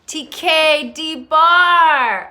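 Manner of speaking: loud, high, drawn-out greeting; excited shout-out. Real, not acted. Streamer hails an arriving viewer, excited shout-out. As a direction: call the name out long and loud.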